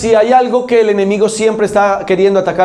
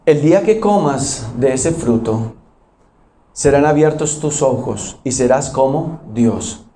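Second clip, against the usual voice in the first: the enemy tempting; 6 semitones lower than usual. Acted. Preacher voicing the serpent to the congregation, low and sly.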